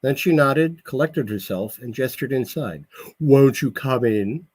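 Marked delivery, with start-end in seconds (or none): 3.2-4.5 slow, overly refined Brooklyn hostess; a Brooklyn woman straining to sound elegant; hiding Brooklyn accent. A narrator's reading. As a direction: Brooklyn accent masked by fake poshness. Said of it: "attempting to hide her accent again".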